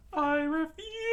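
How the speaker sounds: very high, loud, slow refusal; defiant high voice. Acted. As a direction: refuse flatly. Sanji defies Krieg.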